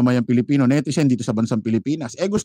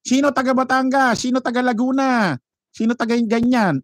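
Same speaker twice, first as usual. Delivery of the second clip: higher mock of a speaker calling out provinces; mock roll call; 8 semitones higher than usual. Vlogger mocking the speaker calling out provinces, higher mock voice. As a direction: ham up a stage roll call. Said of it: "may nagsabi pa".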